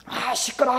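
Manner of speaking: high, strained cry of annoyance; irritated yell. Acted. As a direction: act out snapping at noise from the back room. Irritated family member yells at noise.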